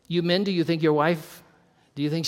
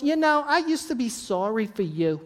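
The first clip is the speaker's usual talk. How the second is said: higher-pitched imitation of a New York woman; mock New York accent, pitched up; 5 semitones higher than usual. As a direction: do a hammy New York lady. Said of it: "That's my New York".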